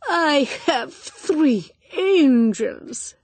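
high, slow, adoring voice; Spanish senora gushing about her children; doting Spanish lady. A narrator's reading. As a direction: linger fondly over the angels. Senora de Caspiaro speaks.

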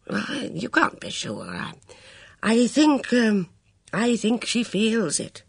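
put-on a kindly old man struggling to say it; hesitant elderly officer, earnest; hesitant old colonel. Luscombe tentative.

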